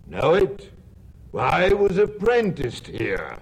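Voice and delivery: surprised. Acted. Scrooge recognizes old place.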